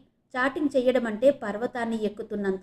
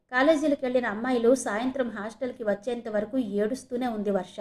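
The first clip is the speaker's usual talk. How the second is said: plain reading voice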